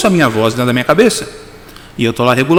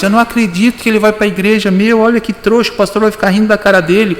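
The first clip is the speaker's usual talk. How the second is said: voz debochada; 8 semitones higher than usual. Retold or imitated. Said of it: "E a, a voz".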